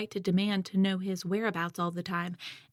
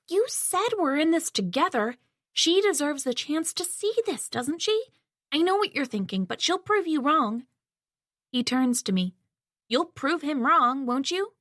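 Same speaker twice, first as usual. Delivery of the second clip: eager pleading; 6 semitones higher than usual. A narrator's reading. Henry eagerly pleads with Bob.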